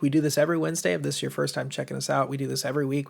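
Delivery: normal talking voice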